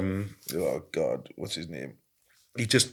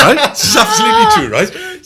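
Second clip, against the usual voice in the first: laughing; 14 dB louder than usual. Real, not acted. Laughing while insisting story true.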